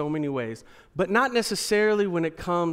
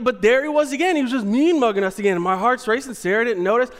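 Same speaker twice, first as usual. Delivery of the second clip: ordinary talk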